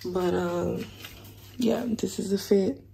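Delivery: lost voice, hoarse and soft. Real, not acted. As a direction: speak through a voice that's gone. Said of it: "my voice is gone".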